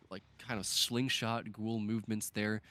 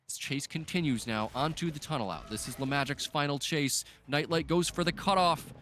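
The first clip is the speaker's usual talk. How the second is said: raised, driving commentary peaking on the cutoff; excited play-by-play; 5 semitones higher, 4 dB louder than usual. Real, not acted. Caster calling a player's final chase, excited play-by-play. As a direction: call the chase with mounting urgency.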